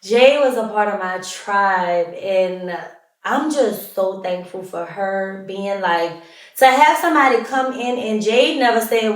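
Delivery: ordinary talk, nothing marked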